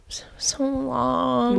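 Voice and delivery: drawn-out whispered moan; slow whisper. Real, not acted. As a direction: stretch it out, weary. Exaggerated complaint about sickness.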